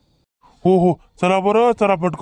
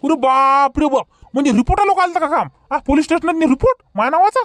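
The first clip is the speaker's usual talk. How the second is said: higher, faster burst of alarm; alarmed surprise; 7 semitones higher than usual. Acted. Drunk man alarmed that a police report was filed, fast and higher. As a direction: panic at the thought of a police report.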